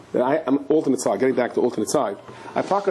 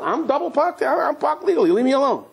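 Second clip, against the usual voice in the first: much higher, petulant excuse; stubborn actor; 12 semitones higher than usual. Acted. Actor refusing the director's demands, high and petulant. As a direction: brush everyone off, whiny.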